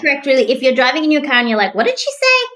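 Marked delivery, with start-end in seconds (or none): 1.8-2.5 high incredulous question; mock disbelief. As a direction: pretend you misheard in shock. Imagined driver hearing stat.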